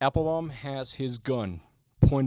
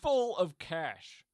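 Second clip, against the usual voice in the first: ordinary talk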